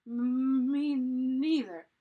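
loud, drawn-out shuddering reply; shivering. A narrator's reading. Jack agrees after the icy dip, shivering. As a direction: stretch the sounds with cold shudders.

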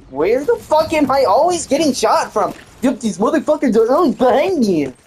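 high, exasperated complaint; outrage at being shot; exasperated outburst. Real, not acted. Gamer shot from behind, high exasperated outburst.

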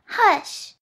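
put-on high, loud childish coaxing; little girl voice. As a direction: call him along like a child. Bonesaw soothing.

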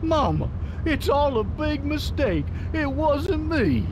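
high, drawn-out imitation of a wailing prisoner; mock crying out. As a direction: mimic a grown man wailing for mama. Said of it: "he starts crying out for his mama".

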